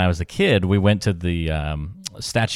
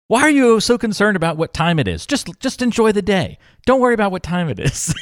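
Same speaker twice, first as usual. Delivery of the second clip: grandmother yelling; 12 semitones higher than usual. Retold or imitated. Grandmother snapping at the grandchild about the time, high mock scold. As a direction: play the exasperated grandmother, raised high. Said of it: "she, like, yelled at me".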